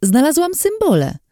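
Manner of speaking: high, delighted exclamation, pitch leaping up; joyful cry. Acted. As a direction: shout it out like you just found treasure. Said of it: "krzyknęła uradowana".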